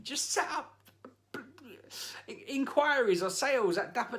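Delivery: furious rant at a business with a hotmail email address; exasperated rant. Real, not acted. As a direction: yell it at the van owner in disbelief. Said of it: "already at nine out of ten anger".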